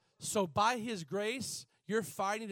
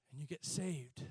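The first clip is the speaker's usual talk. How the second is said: much softer, lower repeat; quiet echo; 6 semitones lower, 9 dB quieter than usual. Real, not acted. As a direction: drop it to a soft hush. Quiet repeat after shouting.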